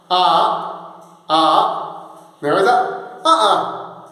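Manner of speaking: "Ah, ah" cut sharply by a glottal stop; glottal stop demo. Shown as a demonstration. Teacher shows students how the glottal stop cuts "ah". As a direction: catch the throat between each "ah". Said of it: "glottal stop demo".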